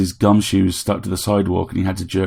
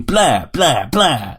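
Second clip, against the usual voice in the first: high shrieking blah blah blah; a toy vampire shrieking as it flies in; shrieking vampire bot; 6 semitones higher, 4 dB louder, much slower than usual. A narrator's reading. Vampire robot shrieking at Harry on attack.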